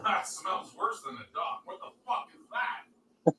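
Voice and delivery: whispered, grossed-out groan; disgusted. Real, not acted. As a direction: recoil from a bad smell. Disgusted at a smell.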